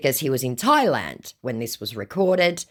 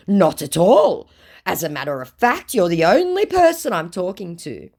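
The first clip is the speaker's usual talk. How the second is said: raised voice, mock-earnest denial; lying scammer; 6 semitones higher, 6 dB louder than usual. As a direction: insist falsely as the scammer. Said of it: "Completely lying through his teeth".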